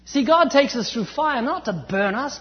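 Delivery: ordinary delivery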